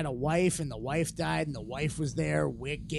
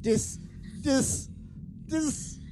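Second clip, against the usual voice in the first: rising each time; 11 semitones higher, much slower than usual. Acted. Rising repeated word.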